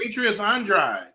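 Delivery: ordinary talk, nothing marked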